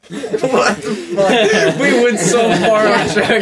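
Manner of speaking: high, loud words through laughter; laughing. Real, not acted. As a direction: laugh while saying it. Laughing at the tangent.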